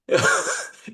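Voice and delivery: voiceless embarrassed laugh; साँस भरी हँसी; breathy laugh. Real, not acted. Embarrassed at receptionist's remark. (बिना आवाज़ की शर्मीली हँसी)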